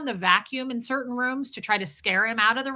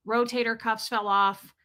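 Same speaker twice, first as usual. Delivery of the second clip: ordinary talk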